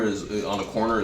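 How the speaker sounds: ordinary delivery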